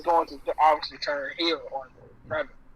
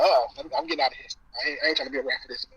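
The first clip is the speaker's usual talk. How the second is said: hurried; 3 semitones lower, much faster than usual. Retold or imitated. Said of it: "he's like".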